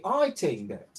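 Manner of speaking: posh Queen Elizabeth English; British accent. Shown as a demonstration. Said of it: "Talk like a British".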